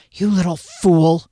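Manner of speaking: slow, weighted angry exclamation; muttered curse. A narrator's reading. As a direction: let the frustration out in a slow oath. Derry sags, cursing Kier.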